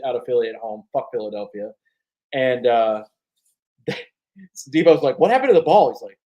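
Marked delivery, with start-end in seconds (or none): 5.2-5.9 high, loud, incredulous question; mock baffled question. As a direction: ask it like a stunned teammate. Retold Deebo asking Kittle.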